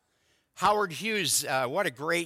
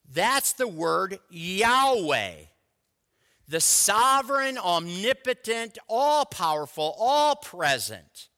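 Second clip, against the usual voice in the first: rising preaching; 3 dB louder than usual. Acted. Pastor proclaiming God's names to the congregation, building fervour. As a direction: build each title with fervour.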